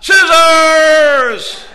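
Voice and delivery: high, drawn-out full shout; defiant yell. Acted. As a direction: yell it stubbornly at the top of your voice. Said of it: "she yells out".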